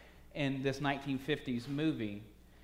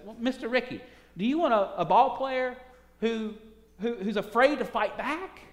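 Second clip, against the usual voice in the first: higher, earnest challenge; Jackie pushing back; 6 semitones higher, 4 dB louder than usual. Acted. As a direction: player asking the manager, earnest and high. Jackie answers Rickey.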